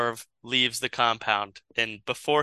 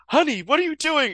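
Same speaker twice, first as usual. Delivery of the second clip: high-pitched mock-alarmed wife; 14 semitones higher, faster than usual. Wife faces the gun in story.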